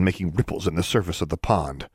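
plain reading voice

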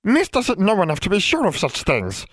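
high, cross elf rebuke; snapping. A narrator's reading. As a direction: snap back in a high elf voice. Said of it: "the elf snapped".

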